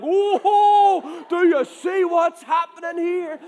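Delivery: high, loud imitation of an excited friend; hammed-up excited yell; animated excitement. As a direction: shout it, thrilled and over the top. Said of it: "I can see him getting visibly animated".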